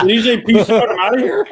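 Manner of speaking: high laughing question. Real, not acted. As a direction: laugh through the words. Laughing at frozen caller.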